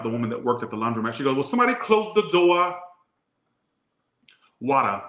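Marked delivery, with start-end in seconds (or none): 1.4-2.8 high mock Boston holler; screaming Boston accent. Laundromat worker yelling to customers, Boston accent. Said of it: "I heard the lady scream out". 4.6-5.0 mock Boston pronunciation, final R dropped; Boston accent. Showing off how Boston talks, Boston accent. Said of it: "Oh, I love that accent".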